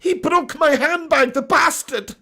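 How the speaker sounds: put-on high, slow old Scottish nanny falsetto; high nanny voice, outraged and slow; Mrs Doubtfire impression. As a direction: squeak it out like an indignant old nanny. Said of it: "a Missus Doubtfire impression".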